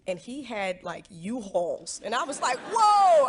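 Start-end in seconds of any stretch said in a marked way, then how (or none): 2.7-3.3 big, loud, high-pitched whoa of shock. Retold or imitated. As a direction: blurt it out like you can't believe the size of it. Reacts to fiance's U-Hauls.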